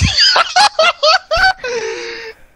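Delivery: loud high laugh. Real, not acted. Friend nukes himself in game.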